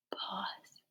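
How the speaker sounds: whispered cue word. Shown as a demonstration. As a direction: whisper it as a reminder to pause. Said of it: "I've just whispered pause a few times".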